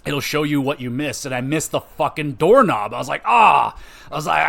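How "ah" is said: angry yell. Retold or imitated. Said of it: "getting so angry at that part".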